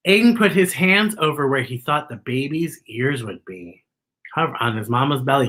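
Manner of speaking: plain reading voice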